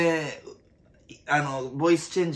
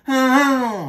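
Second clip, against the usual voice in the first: higher, louder, slow Pokémon call; Geodude cry; 11 semitones higher, 8 dB louder, slower than usual. Retold or imitated. Comedian redoing the Pokémon cry louder and slower. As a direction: do the rock Pokémon's cry, stretched. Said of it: "石つぶての方が".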